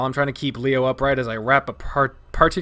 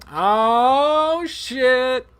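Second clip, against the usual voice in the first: panicked yelp; 13 semitones higher, 7 dB louder than usual. Acted. Panicked inner thought.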